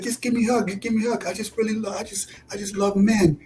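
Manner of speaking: high, softened imitation of a gushing woman; imitated female barber.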